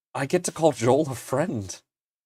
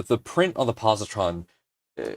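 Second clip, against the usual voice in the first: ordinary delivery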